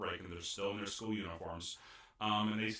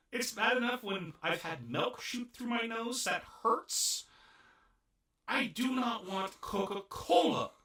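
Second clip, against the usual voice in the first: comic dread; 9 semitones higher, 4 dB louder, slower than usual. Real, not acted. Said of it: "I just thought about how painful that would be".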